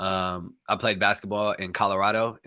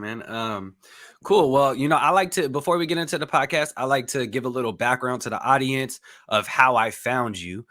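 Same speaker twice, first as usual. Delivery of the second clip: ordinary talk, nothing marked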